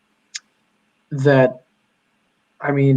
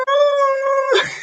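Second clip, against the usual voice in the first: put-on much higher tearful toddler cry; toddler's crying wail. Dad imitating daughter crying at his fall, high wail. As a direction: wail like a startled little girl. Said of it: "And she was like".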